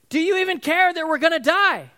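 high, loud accusing shout; panicked yelling. Acted. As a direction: confront him as if about to drown. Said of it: "This is a guy holding a bucket, shoves it in his face".

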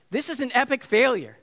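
a dad venting about a failed outing; frustrated. Retold or imitated. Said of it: "I was so frustrated".

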